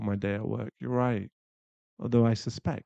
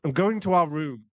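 angry exit; 5 semitones higher, 8 dB louder than usual. Acted. Storms off angrily.

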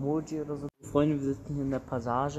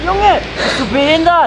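high, loud frightened yell; angry scared shout; 19 dB louder than usual. Real, not acted. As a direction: scream at the rider. Scared passenger on motorbike.

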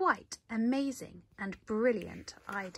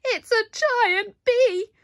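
very high, loud, slow scream; a scared bear screaming; panicked squeal; 13 semitones higher, 12 dB louder, slower than usual. A narrator's reading. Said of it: "squealed the bear".

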